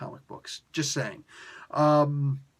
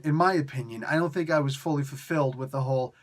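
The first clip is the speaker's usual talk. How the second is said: ordinary delivery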